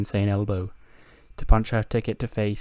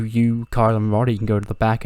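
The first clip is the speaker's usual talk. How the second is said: usual speaking voice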